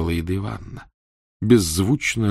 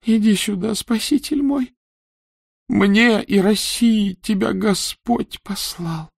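higher, slow, a hint of weak gratitude; weak empress voice; 13 semitones higher, 5 dB louder, slower than usual. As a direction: call him tenderly, weakly. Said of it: "донёсся слабый голос".

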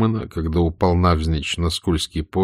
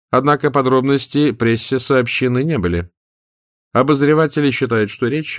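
higher, formal news-bulletin read; radio announcer; 3 semitones higher than usual. Acted. A London radio announcer reads the news, formal. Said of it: "читал лондонский диктор".

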